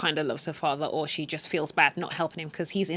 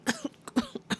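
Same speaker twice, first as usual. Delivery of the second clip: cough; 7 dB quieter than usual. Retold or imitated. Father coughing at stairs bottom.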